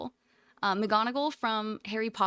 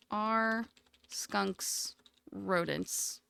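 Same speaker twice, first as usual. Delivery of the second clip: slow, drawn-out question; 3 semitones lower, 4 dB quieter, much slower than usual. Real, not acted. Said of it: "slow repeat".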